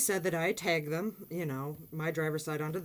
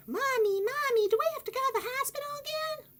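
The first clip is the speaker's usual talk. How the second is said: put-on much higher, childlike plea; little girl voice. Little daughter asking her mother about the hospital. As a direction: imitate a small child asking mommy.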